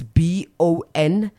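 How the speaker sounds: "B O N Z O U R" given letter by letter; spelling it out. Shown as a demonstration. Host spells the greeting for listeners, spelled out. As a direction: spell each letter separately and clearly. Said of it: "I hope I'm pronouncing it properly".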